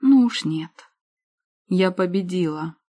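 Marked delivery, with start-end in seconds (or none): 1.7-2.8 firm and steady, no wavering. A narrator's reading. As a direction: state it flatly, eyes locked. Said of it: "произнесла твёрдо".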